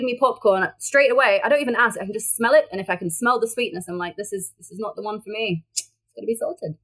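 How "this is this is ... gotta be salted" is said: much softer, fussy little verdict; mock refusal. To people offering sweet popcorn, a soft picky refusal. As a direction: sniff it and quietly turn it down.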